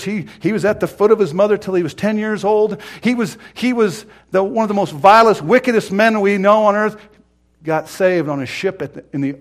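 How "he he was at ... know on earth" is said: high, loud preacher's voice; full-force preaching. Real, not acted. Preacher driving the story home to the congregation, full-force preaching. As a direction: press the point home, pitched up and loud.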